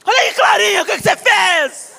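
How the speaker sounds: bronca na filha. Retold or imitated. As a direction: yell at the girl, half laughing. (mãe gritando com a filha, meio rindo)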